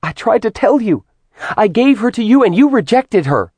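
high, heated outcry; indignant protest. A narrator's reading. Artemis protests that he rejected their child, indignant.

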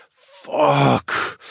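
a rising, strained groan of a curse. Acted. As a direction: let it out as a groan. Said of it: "I groaned".

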